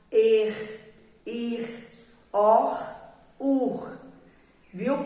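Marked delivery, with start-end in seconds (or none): 0.1-4.0 "er", "ir", "or", "ur" each ending in a throaty scraping R; isolated vowel plus raspy R; syllable-final R. Shown as a demonstration. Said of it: "aquele finalzinho que arranha a nossa garganta".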